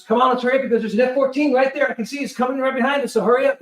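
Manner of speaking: urgent hurry-up. Retold or imitated. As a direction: push them off the deck fast. Crew rushing him off deck.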